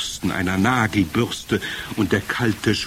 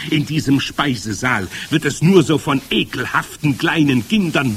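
lively, raised witch voice with swooping pitch; commanding head witch; 6 semitones higher, 4 dB louder than usual. Acted. Head witch instructs her assembled witches before dinner, commanding. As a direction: address the coven loudly, pitch leaping on orders.

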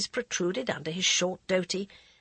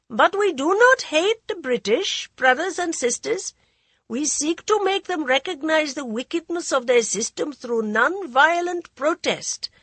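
high, loud speech to a crowd; high goading voice; 8 semitones higher, 5 dB louder than usual. Acted. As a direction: rouse the crowd, high-pitched. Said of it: "The high voice continued to goad them".

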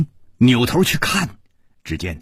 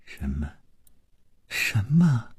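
stammering, climbing higher with shock; shaken, breathless stammer; stunned disbelief; 8 semitones higher than usual. A narrator's reading. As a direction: gasp out the questions, dying. Dying woman shocked, stammering.

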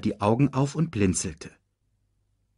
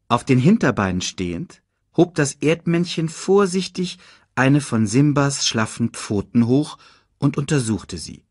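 plain reading voice

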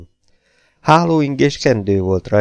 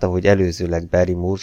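ordinary delivery

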